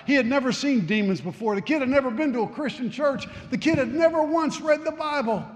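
high, loud storytelling sermon; dramatic preaching. Acted. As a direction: drive the story home. Preaching the story at full force.

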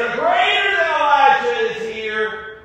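high, loud, ringing proclamation; preacher shouts Jesus' words high and loud; proclaiming shout. Acted. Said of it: "is what Jesus said".

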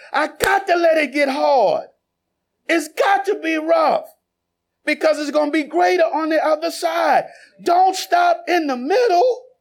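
rising, insistent sermon voice, punching the key lines; fervent preaching. Acted. Pastor preaching to the congregation, fervent and insistent. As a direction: preach it to the back row.

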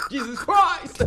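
high laughing "Jesus Christ"; shocked laugh; laughing exclamation. Real, not acted. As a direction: cry out in amused disbelief. Laughing disbelief at girls arriving.

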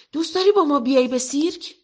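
bursting to ask her along; boy inviting his friend to the circus; excited invitation. Acted.

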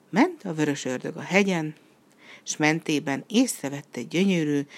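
plain reading voice

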